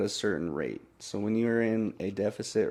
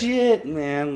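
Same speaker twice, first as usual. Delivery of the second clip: higher, louder mock disbelief; retold amazement; 6 semitones higher, 6 dB louder than usual. Guest recalling his sweaty shirts, retold amazement. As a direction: exclaim it like a stunned retelling. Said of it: "I'm like".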